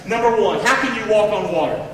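ordinary talk

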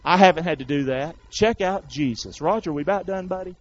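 normal talking voice

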